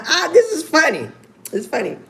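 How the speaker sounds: usual speaking voice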